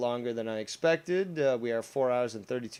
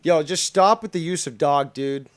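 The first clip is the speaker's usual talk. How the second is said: scolding call-out; 4 semitones higher, 7 dB louder than usual. Real, not acted. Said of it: "Again, indoor voice".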